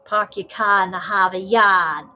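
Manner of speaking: imitating a Boston accent, dropping the r's; mock Boston accent. Imitating Richie and Wojo's Boston accent, playful Boston accent. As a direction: do the Northerners' Boston accent for fun. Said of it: "I like hearing you, uh, the Northerners talk".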